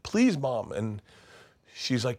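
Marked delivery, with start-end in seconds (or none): 0.0-0.7 high-pitched acted begging; tearful pleading. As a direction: plead like a crying child trying to be recognised. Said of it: "And he's crying".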